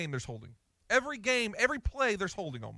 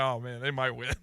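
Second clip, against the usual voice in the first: lower, said through a laugh; laughing disbelief; 7 semitones lower than usual. Retold or imitated. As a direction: laugh as you concede it. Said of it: "I'm like".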